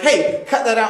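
a mock scolding called out; sharp rebuke. Rebuke that gets thrown back.

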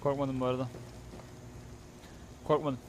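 low, soft understatement; subdued denial. Real, not acted. Gamer insisting he wasn't scared, quiet and low. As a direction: say it quietly, as if nothing happened.

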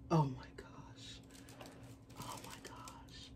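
soft breathless whisper; hushed amazement; awed whisper. Real, not acted. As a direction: whisper it in disbelief. Awed at signed shoe.

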